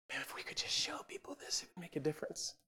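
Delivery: whispered wish, retold; very soft, airy whisper; retold hushed thought. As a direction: say it under your breath, as if thinking aloud. Quoting a hushed private thought.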